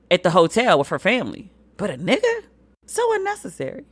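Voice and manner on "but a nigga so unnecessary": unnecessary pitched way up; exasperated. Real, not acted. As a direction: let it rise in disgust. Outrage at slur.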